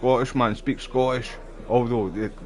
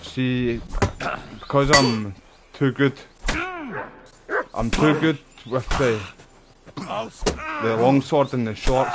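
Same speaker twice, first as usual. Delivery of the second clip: louder, slightly higher cocky brag about his skill; excited boast; 4 semitones higher, 6 dB louder than usual. Real, not acted. Gamer boasting to viewers he's too good with swords, loud excited brag. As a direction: talk yourself up like you're too good.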